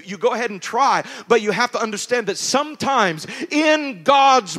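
loud, high, fervent preacher build; full-throated sermon climax; preaching at full force. Acted. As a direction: preach it out to the congregation.

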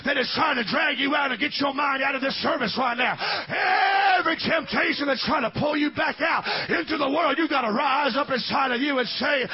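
high, loud shouted preaching over music; full-force preaching. Acted. Pastor urging the congregation to rise, shouted preaching. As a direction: preach it out to the whole church, climbing.

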